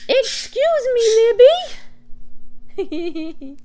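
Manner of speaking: very high squeaky pet voice; cooing to pet. Real, not acted. Calling to Libby the bird, high pet-talk voice. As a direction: squeal it sweetly at a little pet.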